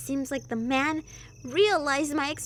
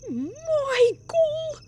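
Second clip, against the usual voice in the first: high, loud character call; put-on character voice; 4 semitones higher, 7 dB louder, slower than usual. Sister calling out to Michael, high put-on character voice. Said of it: "I don't know why I gave her that voice".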